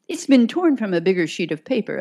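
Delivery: fast, hurried delivery; detective cutting in to protect her client, quickly. Acted. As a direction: rush it out before he can answer. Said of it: "I said quickly".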